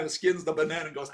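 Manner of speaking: usual speaking voice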